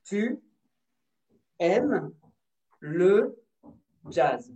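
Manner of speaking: word by word. Shown as a demonstration. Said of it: "est-ce que c'est avec cette main".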